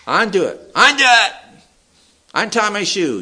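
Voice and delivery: put-on high, insistent toddler protest; stubborn little child. Small child insisting on managing without help, high stubborn voice. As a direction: pipe up like a determined small child. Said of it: "like little children that want to do things".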